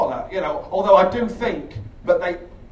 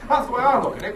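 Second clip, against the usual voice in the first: ordinary talk, nothing marked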